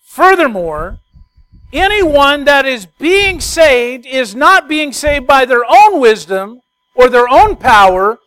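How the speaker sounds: loud, high, slow preaching; preaching at full force. Acted. Preacher hammering the main point to the congregation, loud and slow.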